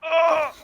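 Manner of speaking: high, loud squeal; startled squeal. Real, not acted. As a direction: let out a sharp squeal. Squealing reaction to the voice.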